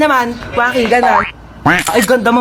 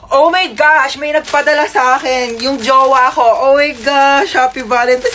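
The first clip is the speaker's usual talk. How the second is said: giddy, lovestruck thrill; 3 semitones higher, slower than usual. Acted. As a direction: squeal over a gift from a sweetheart. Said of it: "Kinikilig daw ako sa jowa ko".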